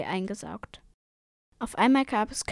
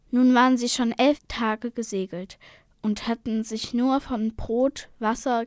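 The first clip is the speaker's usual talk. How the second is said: ordinary delivery, nothing marked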